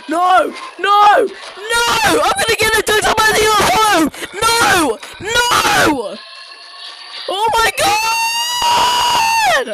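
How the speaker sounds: high, loud panicked screams; loud shrieking panic, pitch shooting way up; scared screaming. Real, not acted. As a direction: scream in fright, stretching the cries out. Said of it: "I screamed because Burma scared me".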